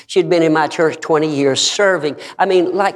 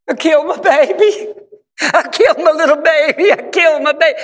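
high-pitched wail of a retold girl; imitated crying; 12 semitones higher than usual. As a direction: imitate a distraught girl crying out over her baby. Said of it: "they were all crying".

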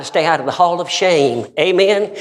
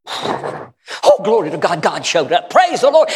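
high praise shout; 5 semitones higher, slower than usual. Real, not acted. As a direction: praise the Lord, leaping up high. Preacher exclaiming in praise.

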